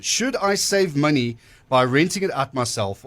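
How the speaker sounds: ordinary delivery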